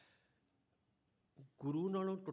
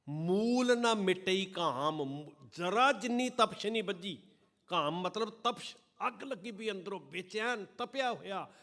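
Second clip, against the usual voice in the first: loud and higher, preaching at full force; impassioned loud sermon; fervent preaching; 7 semitones higher, 9 dB louder than usual. Acted. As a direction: thunder it at the congregation.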